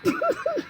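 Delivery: squeaky burst of laughter; short, high cackle; high giggle. Real, not acted. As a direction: crack up mid-performance. Laughs mid rap.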